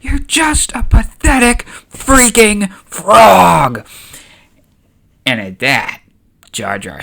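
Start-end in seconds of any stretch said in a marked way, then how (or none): 0.0-3.9 slow, high, furious yell; screamed insult. Acted. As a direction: scream it, punching each word louder. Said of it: "Mothma runs out and screams".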